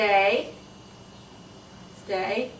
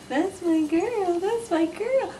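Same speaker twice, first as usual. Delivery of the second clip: much higher, slow sing-song; high sing-song praise; 7 semitones higher, slower than usual. Real, not acted.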